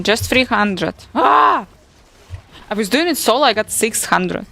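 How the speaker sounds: ordinary talk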